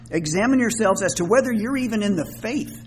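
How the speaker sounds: exasperated, serious admonition; very serious, frustrated. Acted. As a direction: challenge them earnestly, pressing the point. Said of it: "This gets very serious".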